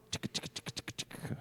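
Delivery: very soft, voiceless rhythmic chicka; whispered chicka beat. Pretend. Host riffs on nicknames for Charles, whispered rhythmic chicka. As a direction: whisper a percussive chicka riff.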